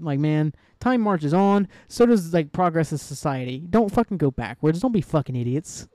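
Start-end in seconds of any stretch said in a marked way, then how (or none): none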